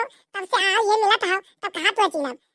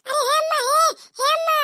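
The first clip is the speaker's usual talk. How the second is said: yelling for mom; 9 semitones higher than usual. Acted. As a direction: wail out for mom from afar. Kallu yells for his mother. (रोते हुए ऊँची आवाज़ में अम्मा को पुकारना)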